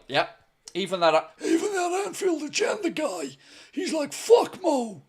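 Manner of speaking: hammed-up owner impression, slowing at the end; John W. Henry impression. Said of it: "That's my best John W. Henry".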